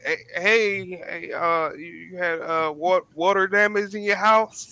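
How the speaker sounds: high, hesitant stammer, retelling a spooked hello; nervous stuttering. Retold or imitated. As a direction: imitate stumbling nervously over the question. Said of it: "I'm stuttering and buttering".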